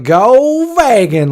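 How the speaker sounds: slow, drawn-out mock "Go vegan", pitched high; sarcastic. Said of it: "we would say it sarcastic".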